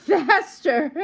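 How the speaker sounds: words broken by a laugh; laughing while reading. Real, not acted. Reader laughing at the children's race to eat. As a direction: laugh as you read it.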